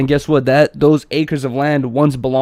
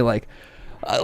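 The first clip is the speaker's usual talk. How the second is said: ordinary talk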